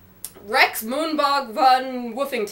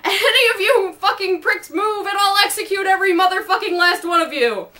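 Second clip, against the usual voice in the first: laughing threat; 8 semitones higher, 4 dB louder than usual. A narrator's reading. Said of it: "Spiffy added".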